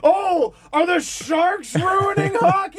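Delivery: slow, high mocking whine; mock fan outrage. Mocking rival fans' complaints about hockey, whining. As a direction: drag it out in exaggerated, high-pitched dismay. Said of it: "fans who were like".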